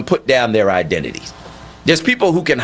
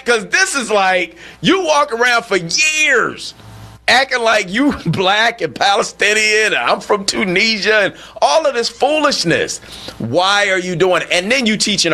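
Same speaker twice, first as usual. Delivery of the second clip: high-pitched, exasperated rant; indignant disbelief; 7 semitones higher than usual. Acted. Host confronting a fake Palestinian teaching our kids, indignant. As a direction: berate the pretender, stretching key words. Host berates the absent pretender.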